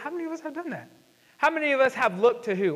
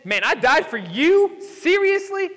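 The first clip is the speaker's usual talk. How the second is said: incredulous, pitch jumping high on Seriously; mock indignation; 7 semitones higher, 4 dB louder, slower than usual. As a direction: scoff in disbelief. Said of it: "Jesus never once said".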